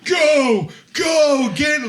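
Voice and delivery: very high, mock-desperate movie shouts; over-the-top yelling. Host imitating Rick screaming at Evie, hammed-up shout. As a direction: ham up frantic, drawn-out cries. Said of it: "It's so over the top".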